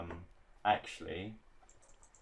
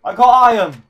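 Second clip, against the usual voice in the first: high, very loud cry of triumph; excited shout; 28 dB louder, faster than usual. Real, not acted. Player surprised by iron from a zombie, shouting excitedly. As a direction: yell it out, thrilled with the find.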